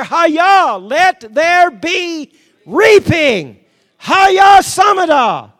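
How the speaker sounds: loud, high-pitched charismatic declaration; full-force preaching. Real, not acted. Preacher declaring God is on the move, full-force shouted preaching. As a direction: proclaim it at a shout, stretching the sacred words.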